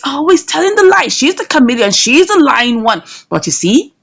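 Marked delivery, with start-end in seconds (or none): none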